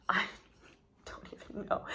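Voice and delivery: soft whispered trailing words. Real, not acted. Sitting in a stranger's truck, whispering to herself. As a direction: breathe it out, barely voiced.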